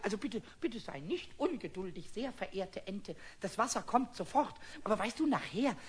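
coaxing. Acted. Pumuckl addressing the rubber duck.

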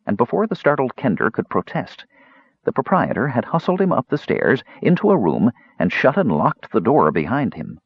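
plain reading voice

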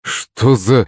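usual speaking voice

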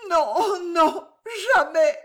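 high, tearful outcry; anguished refusal. A narrator's reading. Sobbing wife refuses to sign.

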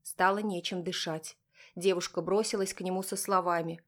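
usual speaking voice

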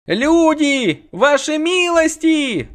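high panting cry, slowed; breathless high alarm, slow; out of breath. A narrator's reading. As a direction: gasp it out after running. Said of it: "задыхался сапожник".

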